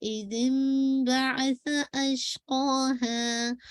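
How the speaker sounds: slow recited इज़िम बअस अशक़ाहा with the m sound; careful tajweed demo of इज़िम; correct reading. Shown as a demonstration. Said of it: "अब इज़िम पढ़ते हैं".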